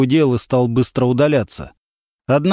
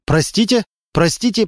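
извиняясь; 6 semitones higher than usual. A narrator's reading. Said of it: "повторял Немирович".

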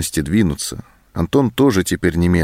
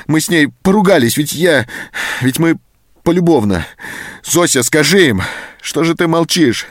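panicked pleading; 9 semitones higher, 3 dB louder than usual. A narrator's reading. Anton begs for his life.

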